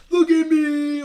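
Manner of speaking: high, loud mock boast; triumphant shout. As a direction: crow it like a champion. Acts out triumph, arms up.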